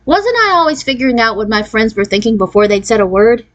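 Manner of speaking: ordinary delivery, nothing marked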